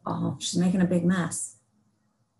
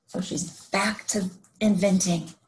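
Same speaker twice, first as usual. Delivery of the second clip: usual speaking voice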